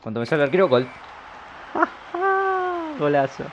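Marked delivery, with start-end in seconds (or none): 0.9-2.9 sudden high-pitched burst of laughter. Real, not acted. Gamer laughing at his own lucky goal, high laugh.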